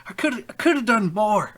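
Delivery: high, loud broken voice, cracking into whispers; sobbing confession. Acted. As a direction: choke out the guilt between sobs. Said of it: "then he breaks down and, and just begins crying again".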